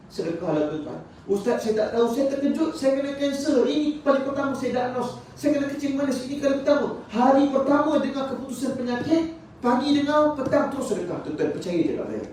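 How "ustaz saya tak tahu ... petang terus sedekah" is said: high-pitched, insistent sermon delivery; high, pressing preacher's voice; urgent preaching. Acted. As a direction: preach the plea and answer in a raised pitch. Preaches through an acted congregant's plea.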